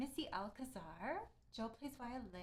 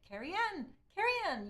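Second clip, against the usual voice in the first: loud, high burst of recognition; excited realisation; 9 semitones higher, 9 dB louder than usual. Real, not acted. Streamer finally getting the pronunciation, excited realisation. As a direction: call out Carrie Ann twice, higher and louder. Suddenly gets the name's pronunciation.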